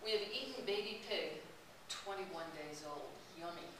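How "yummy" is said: usual speaking voice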